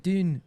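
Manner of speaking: "Dune" with the Scottish oo vowel for "down". Retold or imitated. Actor quoting the AI's Scottish vowels, "Dune" as Scottish "down". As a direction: say "Dune" as a Scot says "down". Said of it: "she was hitting things like Dune".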